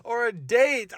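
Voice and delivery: high, incredulous inner voice; exasperated disbelief. Retold or imitated. Date baffled by the dad's grilling, high and incredulous. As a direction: pitch up in disbelief. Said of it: "I'm like".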